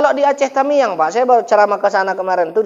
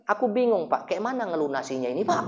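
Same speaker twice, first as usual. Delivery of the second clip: soft bewildered voice; 4 semitones lower, 13 dB quieter than usual. Retold or imitated. Becak driver confused, crying.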